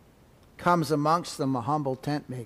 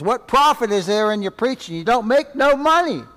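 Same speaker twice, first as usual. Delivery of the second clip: much higher, louder jeer; sneering traitor; 7 semitones higher, 7 dB louder than usual. Acted. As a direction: taunt about money. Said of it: "traitor chimes in and says".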